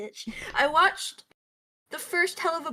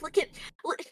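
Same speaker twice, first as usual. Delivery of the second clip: mock excited fangirl; 5 semitones higher, much faster than usual. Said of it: "they're like".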